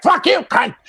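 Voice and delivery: high crude dummy retort; puppet voice. Retold or imitated. Ventriloquist's puppet insulting Claudia, high crude retort. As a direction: snap it back as the puppet. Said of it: "He's like".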